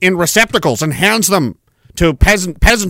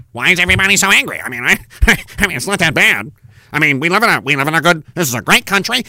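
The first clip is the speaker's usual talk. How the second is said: quick, lower, fake-innocent shrug of a smug millionaire; mocking rich guy; 3 semitones lower, faster than usual. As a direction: rattle it off like a clueless rich man.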